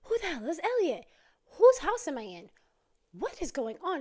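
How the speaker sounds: loud, high-pitched shocked questions; acted-out alarmed disbelief, each question punched; freaking out. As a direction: demand answers in mounting shock. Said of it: "Jacqueline is freaking out".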